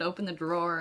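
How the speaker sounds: usual speaking voice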